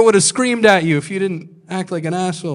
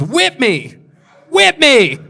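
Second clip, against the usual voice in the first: rising pleading cry, the second much higher and louder; hammed-up begging, climbing to a squeal; mock desperate plea getting shrill; begging guy; 8 semitones higher, 6 dB louder, slower than usual.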